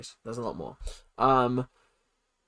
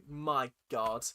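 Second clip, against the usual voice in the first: usual speaking voice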